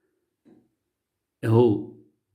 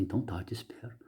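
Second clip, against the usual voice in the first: whisper; 4 semitones lower, 15 dB quieter, much faster than usual. Retold or imitated. Retold chat with the rain.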